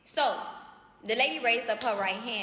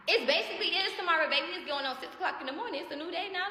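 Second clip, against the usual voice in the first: high mock retort; 10 semitones higher, faster than usual. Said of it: "So I'm like".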